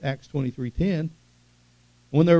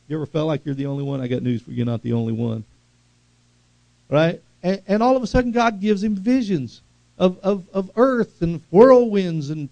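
usual speaking voice

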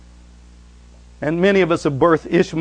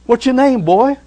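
high and loud, demanding his name; booming angel; 10 semitones higher, 8 dB louder than usual. Acted. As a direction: challenge him, loud and high.

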